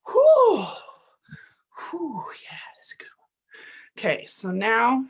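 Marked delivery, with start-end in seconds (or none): 0.1-0.6 a big whooshing release of held breath; loud relieved breath out; loud exhale. Real, not acted. 1.9-3.2 breathy whisper after the exercise; soft whispered approval; whispered aside. Real, not acted.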